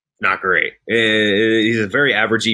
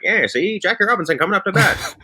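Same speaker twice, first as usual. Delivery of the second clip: put-on old-timey mid-Atlantic announcer accent; lightly done mid-Atlantic accent, higher; 7 semitones higher than usual. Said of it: "like, a mid-Atlantic accent".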